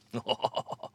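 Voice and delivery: breathy laugh. Real, not acted. Laughing at the dog.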